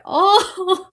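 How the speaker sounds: high, breathy delighted oh; touched gasp. Retold or imitated. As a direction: melt at it, voice jumping up. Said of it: "And I was like".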